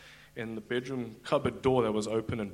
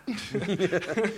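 a higher mock shout with a laugh in it; retold shout; 7 semitones higher than usual. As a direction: bark the order at a stubborn child. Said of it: "he shouted at me like I was a little child".

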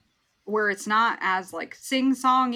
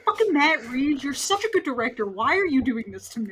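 mock anguished complaint; 5 semitones higher, 4 dB louder than usual. Acts out own future complaint to director.

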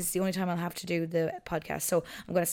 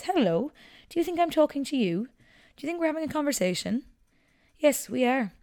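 lightly raised, sing-song voice for the dog; talking to the dog; 7 semitones higher than usual. Real, not acted. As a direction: chat to your dog like he understands. Talking to her dog Albert.

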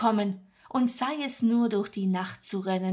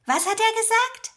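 put-on much higher young woman's voice, puzzled; Marie asking; 13 semitones higher, 6 dB louder than usual. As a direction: voice a bewildered Marie. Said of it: "fragt Marie". (Marie, hoch und verwirrt)

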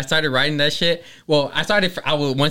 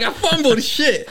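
high, drawn-out mock complaint; amused outburst at your own slip; exasperated self-mockery; 12 semitones higher, slower than usual. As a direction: blurt it high and slow, laughing at yourself. Laughing over own fumbled verse.

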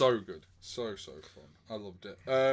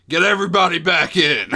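loud bossy demand; demanding shout; 3 semitones higher, 14 dB louder than usual. Retold or imitated. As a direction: shout it like a spoiled star. Acting Kurt Russell demanding.

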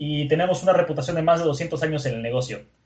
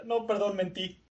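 higher, much softer backing down; meek apology; 5 semitones higher, 9 dB quieter than usual. Retold or imitated. Vendor caught by the neck, meekly apologetic. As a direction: shrink into a small apology. Vendor backs down when grabbed.

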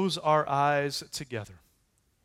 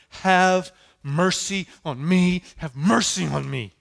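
louder drawn-out plea, slow on "Have mercy on me"; desperate cry for mercy; 5 semitones higher, 8 dB louder than usual. Acted. Job crying out to his friends for mercy, louder and slow. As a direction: cry out begging, stretching each word. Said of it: "this is Job going".

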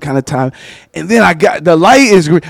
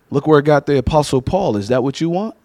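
normal talking voice

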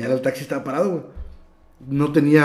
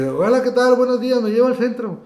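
polite greeting; 9 semitones higher, 3 dB louder than usual. Retold or imitated. Retold friendly greeting to driver.

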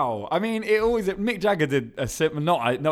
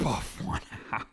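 disgusted whisper; 5 semitones lower, 10 dB quieter, slower than usual. Real, not acted. Repeating the crude phrase.

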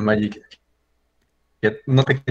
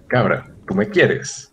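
slow question; much slower than usual. Acted. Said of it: "Aquí está la primera".

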